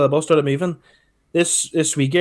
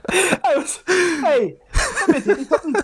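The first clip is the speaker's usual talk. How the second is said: higher, laughing throughout; can't stop laughing while telling it; real laughter; 8 semitones higher than usual. Real, not acted.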